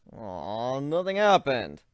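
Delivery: plain reading voice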